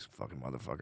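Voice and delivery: very low, hushed curse; quick, quiet inner-voice insult; judging in his head. Retold or imitated. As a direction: mutter it privately, judging a stranger. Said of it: "just judge him in my head".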